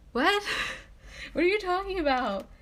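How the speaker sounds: high, loud disbelieving what; a baffled friend reacting to a proud refusal, high and loud; baffled outburst. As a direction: shoot the pitch up in disbelief.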